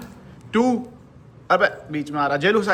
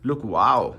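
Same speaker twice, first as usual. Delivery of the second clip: ordinary talk, nothing marked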